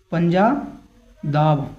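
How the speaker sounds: पंजादाब stretched very slowly, every syllable held; drawn-out word. Shown as a demonstration. Teacher drilling the mnemonic for his students, slow and drawn out. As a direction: spell out पंजादाब slowly so it sticks. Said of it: "क्या हो गया?".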